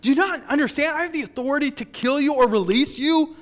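high, loud, exasperated questioning; acted governor losing his patience; frustrated. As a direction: build to shouted disbelief at a silent prisoner. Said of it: "frustrated with him 'cause he's like".

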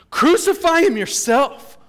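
high, slow, loud retort; exasperated shout. Acted. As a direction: hurl it back at the crowd.

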